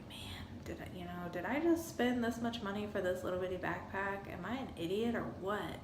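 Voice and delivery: hushed whisper of buyer's remorse; soft whispered second thoughts; whispered self-doubt. Retold or imitated. Inner thought voiced at home.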